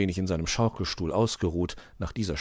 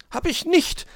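very high, loud protest; insistent; 6 dB louder than usual. A narrator's reading. Little hedgehog contradicts his friend, insistent. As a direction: insist like a stubborn little one. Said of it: "beharrte Borstel".

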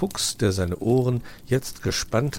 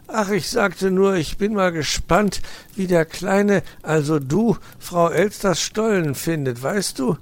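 high, loud flustered excuse; caught stammer; 11 semitones higher, 6 dB louder than usual. Acted. Uncle fox caught out by nephew, mock fluster. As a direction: cover yourself hastily. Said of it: "stammelte Herr Fuchs ertappt".

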